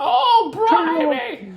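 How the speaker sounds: very high, loud falsetto cockney exclamation; British droid voice. Acted. Rehacked droid exclaiming, still in a high cockney voice. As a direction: squeal it like a cartoon cockney. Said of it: "change it to something else".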